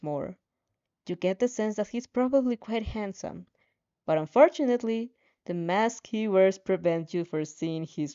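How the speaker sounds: steady, earnest reading aloud; calm second-person story reading; story narration. A narrator's reading. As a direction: read it like an audiobook chapter. Reads fanfic narration.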